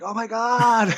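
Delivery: starstruck gasp. Retold or imitated. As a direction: freak out at meeting your hero. Fan recognises the actor.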